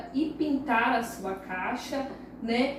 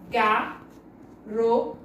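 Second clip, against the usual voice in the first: "Garota" split slowly into ga, ro, ta; "Garota" with a clear pause after each syllable; 3 semitones lower, much slower than usual. Shown as a demonstration. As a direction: say "Garota" as three separate sounds: ga... ro... ta. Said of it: "Olha o som que sai da boca".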